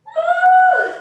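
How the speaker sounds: loud excited shout; loud whoop. Real, not acted. Cheering mid workout.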